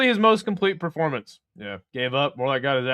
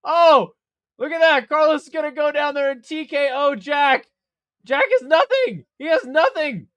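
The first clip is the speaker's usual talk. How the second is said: ordinary talk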